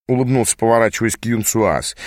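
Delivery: usual speaking voice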